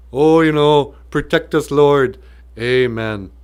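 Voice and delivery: loud, lightly voiced politician's prayer; mock politician praying. Politician closing a prayer, mildly mocking. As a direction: pray loudly in a hint of a pompous politician.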